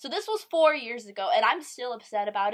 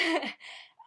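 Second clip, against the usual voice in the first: a quick amused laugh; short laugh; 7 semitones higher than usual. Real, not acted. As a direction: laugh it off briefly. Laughing at her mom's insult.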